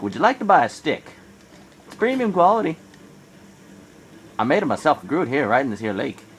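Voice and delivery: high, loud hammed-up salesman; mock pushy peddler; salesman pitch. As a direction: pitch a stick like a huckster. Friend's sales pitch, voiced.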